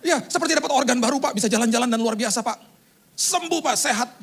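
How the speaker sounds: high-pitched gushing report; excited caller. Retold or imitated. Relative describing recovery to pastor, acted excitement. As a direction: gush as the overjoyed relative.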